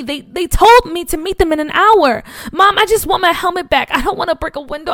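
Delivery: high, loud, urgent begging; tearful pleading. Acted. Boy begging his mother to believe him. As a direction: plead with her, almost in tears.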